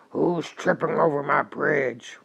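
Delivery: loud troll challenge; roaring troll. A narrator's reading. Troll challenges the second goat on the bridge, roaring loudly. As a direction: roar it out as the troll. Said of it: "roared the troll".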